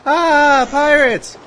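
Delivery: scared crew. Retold or imitated. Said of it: "the enemy crew look at all of you and go".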